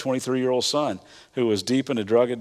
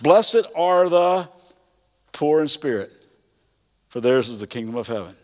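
a preacher voicing Jesus giving the first Beatitude; solemn proclamation; 3 dB louder than usual. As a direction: proclaim it like scripture. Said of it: "Jesus begins this way".

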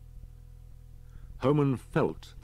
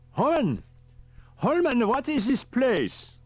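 high, loud urgent calling; urgent professor; 8 dB louder, slower than usual. A narrator's reading. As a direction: call out to him, bewildered.

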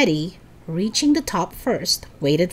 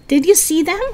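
higher and louder, out of breath from running; breathless panting; 9 semitones higher, 6 dB louder than usual. A narrator's reading. Howie asking friends after fleeing, out of breath. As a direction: gasp the question out. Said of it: "Howie panted".

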